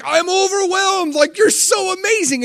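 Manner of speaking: high, excited shout of wonder; overwhelmed joy. Pretend. Preacher voicing a believer overwhelmed by God, excited high shout. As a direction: burst out overwhelmed with delight. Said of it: "And you're like".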